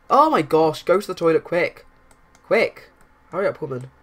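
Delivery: loud, hurried urging; urgent shout. Acted. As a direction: hurry her along, loudly. Urging a character to hurry.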